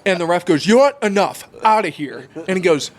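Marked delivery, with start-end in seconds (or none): 0.7-2.3 high, loud ejection order; fed-up referee. Retold or imitated. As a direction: act out a ref throwing someone out. Referee ejecting the dad, retold.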